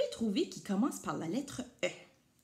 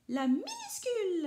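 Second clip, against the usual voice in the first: much higher chirpy echo; bird voice; 10 semitones higher than usual. A narrator's reading. Majou the bird echoing maman, much higher. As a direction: chirp the word back. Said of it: "ajoute Majou".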